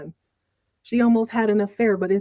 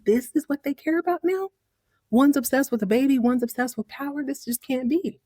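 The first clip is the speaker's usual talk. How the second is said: dismayed disbelief; 6 semitones higher than usual. Retold or imitated. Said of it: "Rebecca's dismayed".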